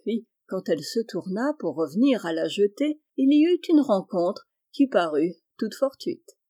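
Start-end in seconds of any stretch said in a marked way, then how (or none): none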